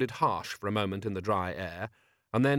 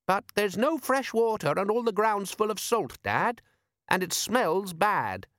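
put-on much higher eager boy's voice; young boy voice; 10 semitones higher than usual. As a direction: pitch up like an eager lad. Son character speaking.

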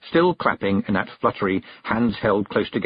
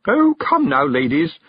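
high, loud coaxing; almost frantic; 7 semitones higher, 6 dB louder, slower than usual. A narrator's reading. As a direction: urge them on, close to panic. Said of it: "now almost frantic".

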